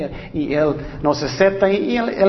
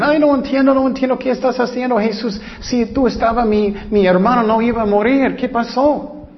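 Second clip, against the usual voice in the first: mild tearful plea; 7 semitones higher than usual. Retold or imitated. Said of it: "estaban llorando mucho".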